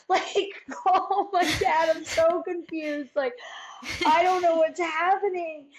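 high, loud, drawn-out imitated begging; desperate pleading. Accused girl begs principal to call her dad, acted desperate pleading. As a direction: plead in exaggerated confusion, stretching the words.

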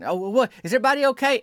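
high, worried is everybody okay; panicked question. Retold or imitated. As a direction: ask in a high, anxious voice. Acted panicked listener.

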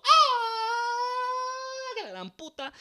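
long high-pitched scream, then a fading curse; hammed-up terrified yell; screaming guys. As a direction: imitate guys shrieking in a crashing car. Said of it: "los tipos gritando".

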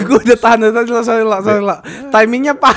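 loud laughing apology. Real, not acted. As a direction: giggle through every word. Can't hold back laughter.

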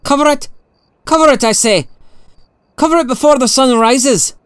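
high-pitched, insistent command; agitated plea-like order, high but not shouted; urgent emotion. A narrator's reading. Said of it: "a trace of emotion in his voice".

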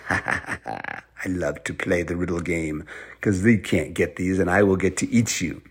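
slow troll voice after an evil chuckle; gloating troll. Acted. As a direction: gloat slowly, relishing the game. Troll gloats over riddle game.

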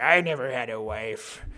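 hammed-up creepy old hermit; creepy old man. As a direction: growl it like a lurking hermit. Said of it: "the creepy old man who comes out".

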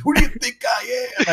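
high-pitched mock outrage; indignant denial. Thief accused of stealing change, mock-indignant. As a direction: protest innocence in a put-on squeal.

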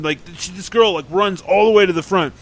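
ordinary delivery

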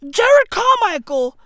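normal talking voice

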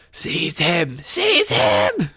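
high, strained villain shriek. A narrator's reading. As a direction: climb into a thin, piercing screech. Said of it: "shrieked Vol".

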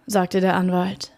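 plain reading voice

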